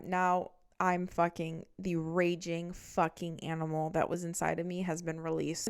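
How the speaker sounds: ordinary talk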